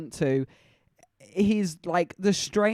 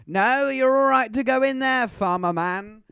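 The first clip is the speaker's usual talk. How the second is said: mock scream; 5 semitones higher, 4 dB louder than usual. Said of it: "hear the mother scream".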